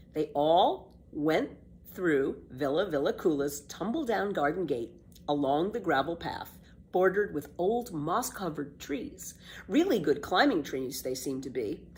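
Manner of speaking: plain reading voice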